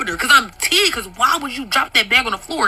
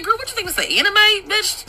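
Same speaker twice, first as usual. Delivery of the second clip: laughing mock disbelief; 10 semitones higher than usual. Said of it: "I was laughing 'cause I'm like".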